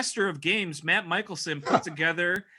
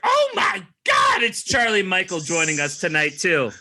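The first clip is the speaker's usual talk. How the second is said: mock commentator shout; 3 semitones higher, 5 dB louder than usual. Host announces surprise guest joining tonight, hammed-up shout. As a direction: holler it like a shocked ringside announcer.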